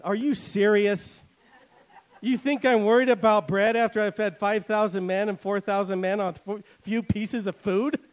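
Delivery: exasperated teacher asking if they're serious; incredulous Jesus. Retold or imitated. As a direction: say it like you can't believe they missed it. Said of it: "in my paraphrase, this is what Jesus said".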